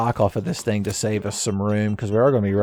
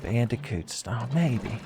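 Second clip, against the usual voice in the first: softer trial run of a catchphrase; hushed, unsure reworking of a line; tentative retry; 8 dB quieter than usual. Shown as a demonstration. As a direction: try the slogan out quietly, half to yourself. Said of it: "I'll workshop this later".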